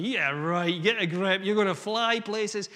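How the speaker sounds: loud sarcastic jeer at a dreamer; mocking scoffer. Doubters jeering at the man dreaming of flight, loud mock sarcasm. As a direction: scoff loudly, like the idea is ridiculous. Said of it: "all these guys going".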